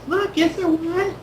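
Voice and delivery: put-on slow, very high child voice; eager little girl. Little girl offering her ear to the magician, eager. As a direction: ask it high and drawn out, full of hope. Same girl asks again.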